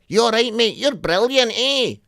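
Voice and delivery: high mock Glaswegian shout; heckler shout. Comic retells audience member calling out, aggressive-sounding friendly shout. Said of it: "he shouted out".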